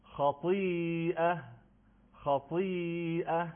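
خطیئه very slow, in a recitation voice; slow recitation model. Shown as a demonstration. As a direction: draw out خطیئه syllable by syllable. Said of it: "و کلمهٔ آخر".

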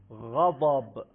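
ordinary talk, nothing marked

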